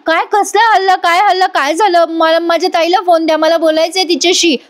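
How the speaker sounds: high, urgent volley of questions; acted frightened alarm. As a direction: imitate a panicked sister demanding news. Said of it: "Kavya घाबरते आणि म्हणते".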